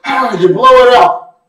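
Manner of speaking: a loud release of held breath; big relieved exhale. Retold or imitated. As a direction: let the breath burst out after holding it. Whooping, finally exhaling.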